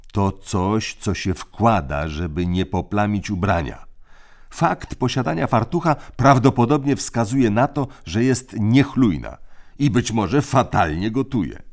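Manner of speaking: crisp orders from the eldest; bossy older brother. A narrator's reading. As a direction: lay down the rules like a little tyrant. Tim explaining.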